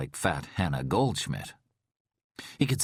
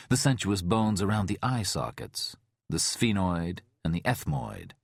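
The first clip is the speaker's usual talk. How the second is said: plain reading voice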